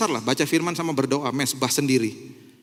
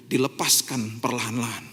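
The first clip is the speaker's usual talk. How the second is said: ordinary delivery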